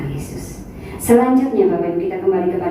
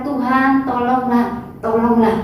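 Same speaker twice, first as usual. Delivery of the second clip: mock desperate plea, higher; imitated crying out; 6 semitones higher, slower than usual. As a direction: beg like the Canaanite woman calling after Jesus. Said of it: "dia berteriak-teriak".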